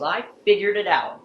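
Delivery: imitating grandpa, high and loud with triumph; proud, delighted retold exclamation; retold happy triumph. Grandpa tells mom he finally understands, retold as happy triumph. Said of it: "And he was so happy".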